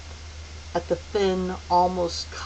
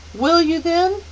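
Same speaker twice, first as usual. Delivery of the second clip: put-on eager girl; 11 semitones higher, 6 dB louder than usual. A narrator's reading. Meg pleads.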